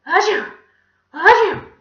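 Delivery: loud, high achoo; acted sneeze. A narrator's reading. Villain with pepper pie in his face, sneezing. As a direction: burst out with a big sneeze. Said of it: "he began to sneeze".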